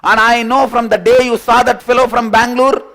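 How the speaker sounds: high, loud, fast accusation; angry girlfriend rant. Retold or imitated. Girlfriend loudly accuses him about the Bangalore fellow. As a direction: keep hammering him with blame.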